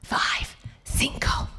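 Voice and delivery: whispered "Five, cinco" like a secret tip. Retold or imitated. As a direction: hush it, teaching it in a whisper. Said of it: "Miguel ... is like".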